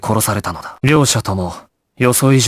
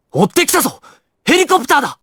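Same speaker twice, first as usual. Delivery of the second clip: alarmed shout; faster than usual. Acted. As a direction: shout the warning upward. Spotting the pursuing helicopter. (警告の叫び)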